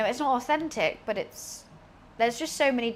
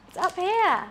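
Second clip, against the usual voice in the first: much higher, sing-song coo at the cat; sing-song pet voice; 8 semitones higher, 4 dB louder than usual. Real, not acted. Owner coaxing cat upward, high sing-song coo. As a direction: coax the cat up in a sweet pet voice.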